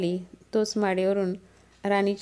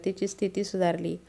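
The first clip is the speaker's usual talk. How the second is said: plain reading voice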